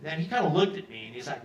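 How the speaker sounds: normal talking voice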